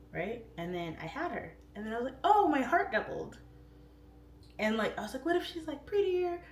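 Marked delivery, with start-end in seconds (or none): 2.2-3.4 high, bright burst of joy; a mother's delighted exclamation, pitched way up; overjoyed gasp. Retold or imitated. Mom recalling second baby. 5.3-6.4 high, whiny mock fret; jokingly voicing a silly fear, much higher; mock jealous worry.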